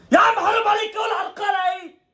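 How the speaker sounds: high, loud outraged yell; angry shout at referee. Real, not acted. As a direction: shout at the ref in real outrage. Yelling at the referee.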